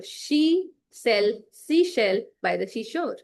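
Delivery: slow, careful tongue twister. Shown as a demonstration. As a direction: sound out each word slowly. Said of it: "Say it slowly".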